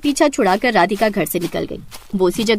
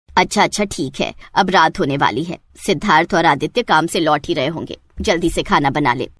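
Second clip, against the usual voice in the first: put-on an older village matriarch giving orders; mother-in-law; 6 semitones lower than usual. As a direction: lower bossy mother-in-law voice. Mother-in-law voiced by narrator.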